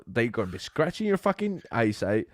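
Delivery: normal talking voice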